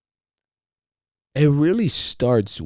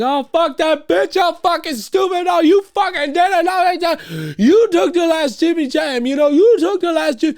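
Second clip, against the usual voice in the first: imitating his own yelling fits; mock yelling; 8 dB louder than usual. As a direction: rant like you're losing it over nothing. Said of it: "crazy yelling problem".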